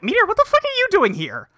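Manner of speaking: villain caught off guard, squeaking high; shocked outburst. Acted. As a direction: jump up high in sudden disbelief. Libra startled by Meteor.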